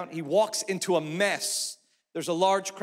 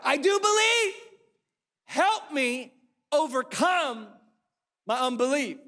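desperate cry; 7 semitones higher than usual. Acted. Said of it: "He cries out".

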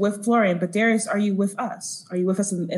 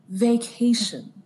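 VACATION drawn out slowly; slow, pointed "Vacation" as reassurance; emphatic coaxing; 3 semitones higher, much slower than usual. Acted. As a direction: stretch "Vacation" at half speed to coax. Coaxing a panicking companion, repeating word.

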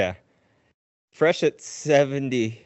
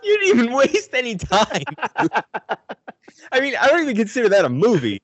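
laughing; 11 semitones higher, 3 dB louder than usual. Real, not acted. Laughing disbelief at pick.

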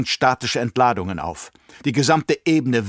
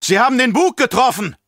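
loud panicked shout; 12 semitones higher, 6 dB louder than usual. Acted. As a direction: scream it over the noise. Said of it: "schrie jemand".

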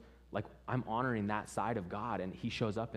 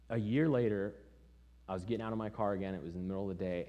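usual speaking voice

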